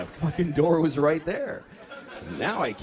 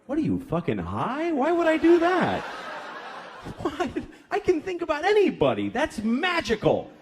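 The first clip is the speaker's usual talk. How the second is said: husband answering his wife in mock disbelief; incredulous comeback; 11 semitones higher than usual. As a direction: scoff at the wife's question, voice jumping up.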